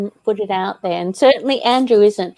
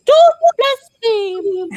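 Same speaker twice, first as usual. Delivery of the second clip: much higher, slow, prim scolding; mimicking a strict mother; 14 semitones higher, 5 dB louder, much slower than usual. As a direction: mimic a prissy mother's reprimand, high and drawn out. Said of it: "she was gonna say".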